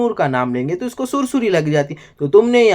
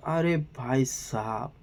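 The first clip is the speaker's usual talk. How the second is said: much softer, slow fed-up sigh; weary sigh; 3 semitones lower, 12 dB quieter, slower than usual. Real, not acted. Vlogger sighs at Tania's excuses, very soft and slow. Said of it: "क्या करें तो भी क्या करें".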